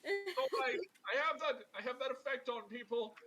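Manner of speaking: high, pompous voice; self-important adventurer. Acted. Alabaster explaining his effect on people, self-important. Vain Alabaster excuses someone fleeing.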